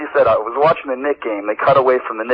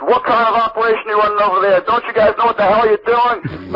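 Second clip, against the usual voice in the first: mock screaming; 8 semitones higher than usual. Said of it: "I'm screaming at the guy".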